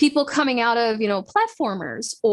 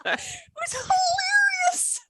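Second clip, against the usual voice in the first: high, squeaky real laughter through words; can barely get it out for laughing; laughing hard. Real, not acted. Laughing about her brother's clan fight, real laughter.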